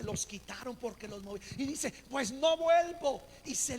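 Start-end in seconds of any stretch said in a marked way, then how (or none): 2.1-3.2 loud, slow, high-pitched huff; mocking an offended churchgoer; huffy vow. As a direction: stomp out swearing never to return. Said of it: "Se enojan con el pastor".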